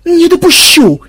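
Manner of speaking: very high, loud outcry; roaring protest. A narrator's reading. Madam rushing to intercept, roaring. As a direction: roar it out at the top of the range. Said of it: "взревела мадам Шварценгольд".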